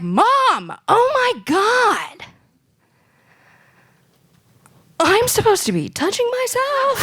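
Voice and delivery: high, slow mock-amazed voice; shocked kid. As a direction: overact dawning surprise. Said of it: "And I'm like".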